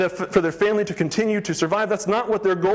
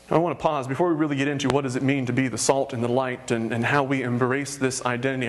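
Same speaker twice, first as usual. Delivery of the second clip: ordinary talk, nothing marked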